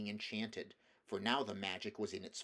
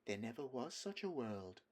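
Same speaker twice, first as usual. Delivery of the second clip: soft lulling witch; 6 dB quieter than usual. A narrator's reading. Witch lulls.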